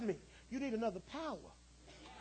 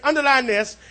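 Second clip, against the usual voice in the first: ordinary delivery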